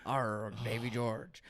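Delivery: slow, hammed-up pirate growl on the name. Joking with a cohost about a spam-call name, slow mock pirate. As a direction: play the name like an old sea pirate, slowly. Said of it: "It sounds like a pirate".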